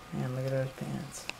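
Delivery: lightly voiced low murmur; mock admiring murmur. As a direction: admire them under your breath, mock.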